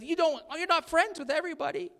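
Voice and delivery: high scolding wife impression. Pastor mimics his wife's scolding. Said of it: "scolded me a little bit and said".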